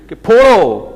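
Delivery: mock-harsh sarcastic command; sarcastic punch. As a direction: spit the word out as a mock order.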